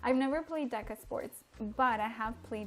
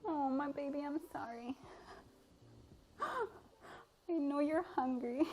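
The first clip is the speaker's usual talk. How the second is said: soft, higher, slow tender pet talk; cooing to the cat; 4 semitones higher, 4 dB quieter, slower than usual. Real, not acted. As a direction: murmur sweetly to your hungry cat.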